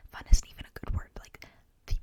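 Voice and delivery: ordinary delivery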